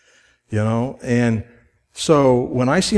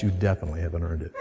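teasing laugh; 9 semitones lower, 9 dB quieter, much faster than usual. Retold or imitated. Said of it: "And you guys just say".

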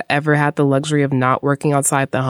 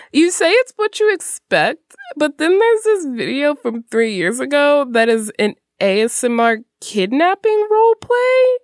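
incredulous; 11 semitones higher than usual. Real, not acted. Incredulous lead-in to odd video.

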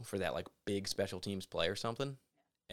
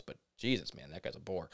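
ordinary talk

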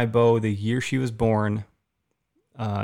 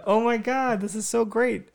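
high-pitched gushing delight; wife hearing about the buck, high and gushing; thrilled wife; 12 semitones higher than usual. Retold or imitated. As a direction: squeal it like she can't believe it. Wife's thrilled reaction retold.

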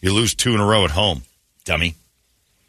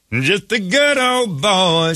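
hammed-up good old boy; 10 semitones higher, much slower than usual. Mocking a country-music fan.

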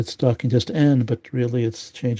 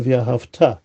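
ve'ahavta with the beat at the very end; stress on final syllable. Shown as a demonstration. Teacher repeating the proper pronunciation, last syllable stressed in ve'ahavta. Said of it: "the emphasis is on the future syllables".

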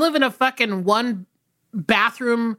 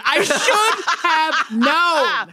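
drawn out slowly through laughter; slow laughing repeat; 6 semitones higher, much slower than usual. Real, not acted. Cohost laughing at her own punchline, slow and giggly. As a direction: stretch it out while cracking up.

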